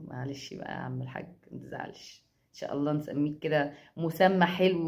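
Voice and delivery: ordinary delivery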